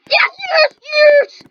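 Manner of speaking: loud, high cheer; triumphant shout. Real, not acted. After finding the hammer, a triumphant high cheer. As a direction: cheer like you just won.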